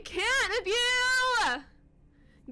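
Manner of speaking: high, loud, drawn-out wail; slow exasperated holler; exasperated yell. Real, not acted. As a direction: stretch each word into a shriek. Yelling at game character after scare.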